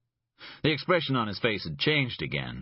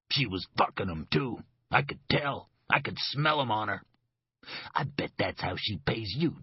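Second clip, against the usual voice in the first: bitter grin. Acted. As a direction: sneer the secret, then drop into menace. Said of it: "A broad, bitter grin".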